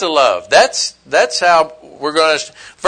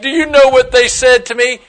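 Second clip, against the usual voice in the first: indignant complaint; 6 semitones higher, 4 dB louder than usual. Retold or imitated. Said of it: "sometimes we're like".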